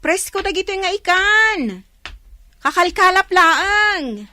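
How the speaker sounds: loud, high market cry; vendor calling out. Acted. Fish vendor hawking fresh catch to buyers, loud high cry. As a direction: hawk the fresh fish to passersby.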